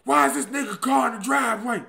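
loud high yell from the lawn. Retold or imitated. Jilted man yelling at his ex from the front lawn, loud. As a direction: shout it up at the closed house, furious. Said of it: "I'm yelling at them".

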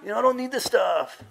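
imitating his old self sassing the boss in Chicano slang, pitched high; Chicano gang slang. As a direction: brush off the boss in loud Chicano street talk. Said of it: "speak like that".

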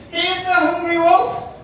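ordinary delivery, nothing marked

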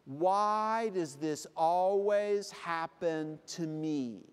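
slow, drawn-out mock whine; hammed-up plaintive complaint; woe-is-me lament. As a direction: stretch every word in self-pity. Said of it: "here comes the phrase. You say".

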